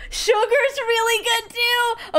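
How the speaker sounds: high, drawn-out squeal of delight; giddy squeal. Real, not acted. Player delighted by a pet name option, giddy squeal. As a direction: gush it slowly, way up high. Squealing over a cute nickname.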